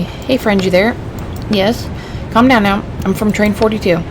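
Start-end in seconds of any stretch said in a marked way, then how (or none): none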